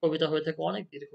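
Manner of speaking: ordinary talk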